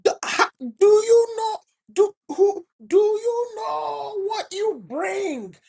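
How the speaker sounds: a mentor nearly crying out the question; impassioned mentor. Retold or imitated. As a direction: plead with fervour, voice breaking. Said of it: "He's like".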